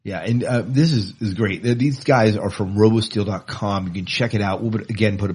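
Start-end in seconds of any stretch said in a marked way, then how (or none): none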